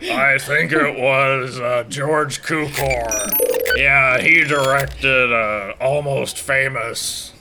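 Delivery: put-on slow, rambling old machine; half-asleep robot musings; AI Brooks voice. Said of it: "I am A I Brooks".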